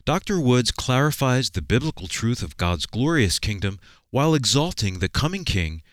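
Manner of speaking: ordinary talk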